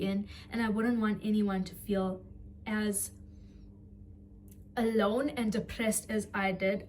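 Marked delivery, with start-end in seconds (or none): none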